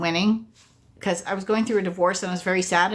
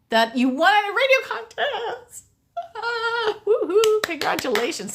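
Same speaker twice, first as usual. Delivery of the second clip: excited cheer; 12 semitones higher than usual. Real, not acted. Congratulating a viewer on a Disney cruise win, high laughing squeal.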